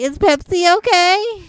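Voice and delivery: high, loud chirpy server voice; waiter asking. Retold or imitated. Waiter offering Pepsi, mock-chirpy, high and loud. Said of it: "and they say".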